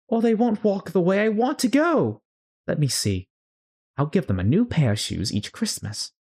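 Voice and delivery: fretful Alice. Acted. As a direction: coo over her feet in a high, worried girl voice. Alice voiced in read story.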